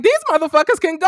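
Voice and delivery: loud, high and fast; defiant shout. Retold or imitated. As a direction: throw it out in a burst. Retelling his defiant outburst.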